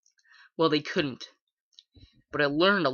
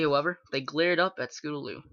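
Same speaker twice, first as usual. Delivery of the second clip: ordinary delivery, nothing marked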